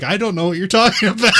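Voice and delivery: high, loud, fast, breaking into laughter; rushed high-pitched burst of American English; student's breakthrough. Retold or imitated. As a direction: imitate a learner suddenly fluent, shouting it delightedly. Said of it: "at the end of it, she's like".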